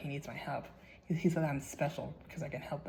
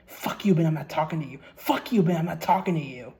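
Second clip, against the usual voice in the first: frantic yelling; 2 semitones higher, 12 dB louder than usual. Retold or imitated. The haunted player screaming at Ben, frantic yell. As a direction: scream it like you're losing it. Diary writer rejects Ben, rising.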